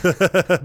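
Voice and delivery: burst of laughter. Real, not acted. Laughing at own nose paper.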